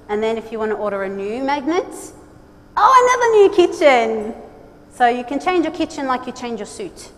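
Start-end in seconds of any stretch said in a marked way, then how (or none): 2.8-4.3 high, loud oh of delight; mock surprise. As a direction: fake astonishment for a laugh. Second reveal, mock surprise.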